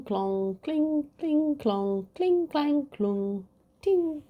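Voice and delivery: high sing-song clang noises; clanging swords. Acted. Narrator voicing the battle's metal clangs. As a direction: ring out the clashing metal, high and bright. Sword clash sound effects.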